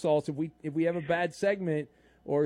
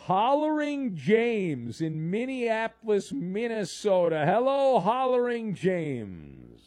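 big drawn-out announcer holler, higher; grand caller introduction; 4 semitones higher, slower than usual. Real, not acted. Radio host introduces Hollering James, grand drawn-out announcement. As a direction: stretch every name out like a ring announcer.

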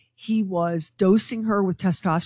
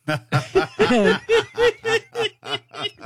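high laugh. Real, not acted. Host laughing at the pastor's fake review, high laugh. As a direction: burst of high delighted laughter.

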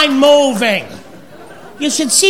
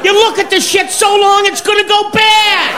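mock yell; 4 semitones higher than usual. Yelling at slow buffet browser.

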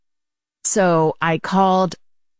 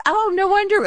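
much higher sudden realization; imitating security guard. Security guard learning about the game, much higher imitated voice. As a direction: pitch the guard way up as it clicks. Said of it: "And he goes".

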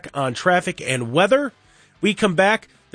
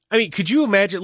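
ordinary talk

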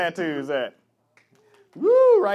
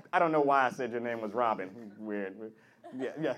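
ordinary talk, nothing marked

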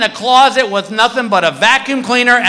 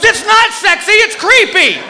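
high, shouted mock-wife rebuke; scolding wife; 13 semitones higher than usual. Wife rejecting husband's sexy surprise, scolding. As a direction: snap back in an imitated scolding.

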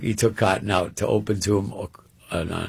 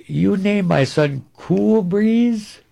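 slow, higher, delighted father; amused father; 10 semitones higher, 5 dB louder, much slower than usual. Retold or imitated. Father grinning at Cotton over dinner, slow and higher. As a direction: draw it out slowly, grinning. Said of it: "He goes".

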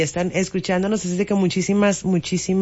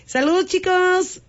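put-on high voice; 11 semitones higher than usual. Teasing greeting to car washers.

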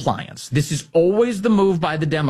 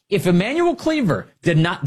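normal talking voice

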